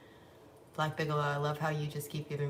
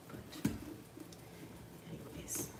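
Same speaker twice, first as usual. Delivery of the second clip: whisper; 11 dB quieter, slower than usual. Real, not acted. Whispered aside.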